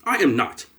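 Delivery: I AM NOT, each word set apart firmly; emphatic denial. Real, not acted. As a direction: land "I am not" with deliberate emphasis. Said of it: "Let me say this with emphasis".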